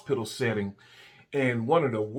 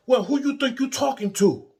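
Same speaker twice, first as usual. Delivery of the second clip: high, indignant imitated northern accent; 13 semitones higher, 5 dB louder than usual. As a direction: mimic a northerner taking offence, loud and high. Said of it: "You know, with the accent".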